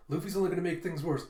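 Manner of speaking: even, quick reassurance at everyday volume; calming down. Retold or imitated. Jinbei calming the crew by quoting Zoro, composed. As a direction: relay it steadily, without alarm. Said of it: "trying to calm him down".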